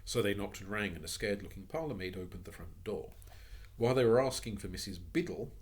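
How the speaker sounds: plain reading voice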